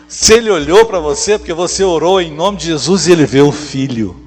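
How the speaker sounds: loud, fervent preaching; preaching shout. Acted. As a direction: shout it to the congregation. Preacher builds to peak.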